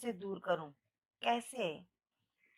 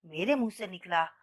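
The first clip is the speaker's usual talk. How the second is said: usual speaking voice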